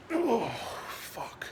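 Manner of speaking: sudden high pained curse, real; pained outburst. Real, not acted. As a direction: let the pain catch you and burst out of you. Sudden pain while sitting.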